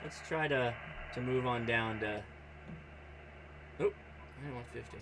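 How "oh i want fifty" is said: usual speaking voice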